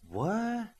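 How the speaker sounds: high, breathless what; stunned. A narrator's reading. Virtuoso walking into the transformed room, stunned and high. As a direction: gasp it out, caught off guard. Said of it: "was all she could say".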